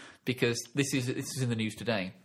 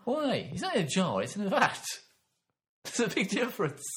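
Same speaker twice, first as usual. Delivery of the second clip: mock pedantic indignation; 9 semitones higher than usual. Real, not acted.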